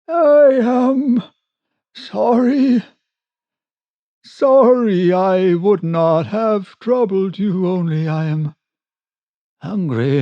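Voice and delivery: high wailing voice, sinking as it goes; hopeless and pathetic. Acted. Hungry castaway apologizes across the water, hopeless and pathetic. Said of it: "sounding extremely hopeless and pathetic".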